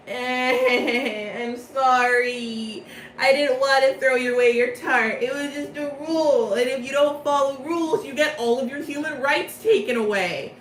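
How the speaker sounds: high, hammed-up tearful wail; mock crying. Riddle tearfully apologizing to Ace after the fight, mock crying. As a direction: wail the apology like a fake crybaby. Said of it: "just 'cause you're crying".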